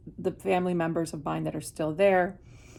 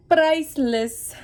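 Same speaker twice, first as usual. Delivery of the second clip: priceless high and louder, stretched out; delighted emphasis; 9 semitones higher, 7 dB louder, slower than usual. Real, not acted. Remembering salon gossip back home, delighted and emphatic. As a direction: relish the word priceless high and slow.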